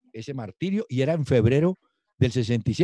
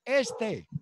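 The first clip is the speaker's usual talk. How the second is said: high, terse "Este" as the sergeant taps a shoulder; sergeant picking recruits; 10 semitones higher, 4 dB quieter than usual. Retold or imitated. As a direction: pick him out like a drill sergeant. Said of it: "Y entonces el sargento decía".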